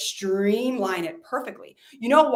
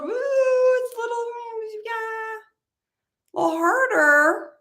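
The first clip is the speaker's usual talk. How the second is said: half-laughing woo; 13 semitones higher than usual. Real, not acted. Pitying outsider guru.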